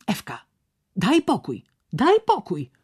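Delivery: exclaimed protest; calling out. A narrator's reading. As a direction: call out insistently, pitch jumping up. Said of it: "zawołał Pochroń".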